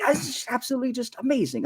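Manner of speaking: high, giddy marvelling; giddy amazement. Acted. Engineer marvelling at the robot, giddy and high. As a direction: gush like a thrilled tinkerer. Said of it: "he's like ... almost giddy".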